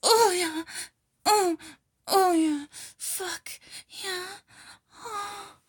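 high, breathless cries, swelling loud then easing; climactic moaning. Acted. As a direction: gasp and moan urgently through the words. Acted climax in intimate roleplay.

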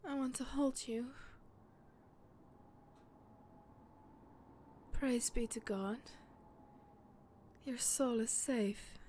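soft intimate whisper. Acted. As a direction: whisper close and tender. Held intimate whisper after climax.

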